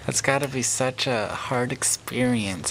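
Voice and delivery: unvoiced hushed condolence; whispered sympathy. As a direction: breathe it out softly in sympathy. Consoling sad friend.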